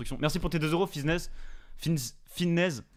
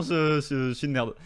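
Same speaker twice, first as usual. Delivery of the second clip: ordinary delivery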